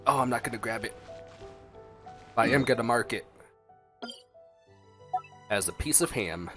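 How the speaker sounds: usual speaking voice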